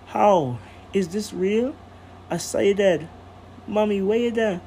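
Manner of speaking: breathless, crying with relief; tearful relief. Acted. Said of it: "struggled to find her breath through the tears".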